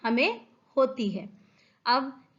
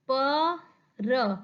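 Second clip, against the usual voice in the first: ordinary delivery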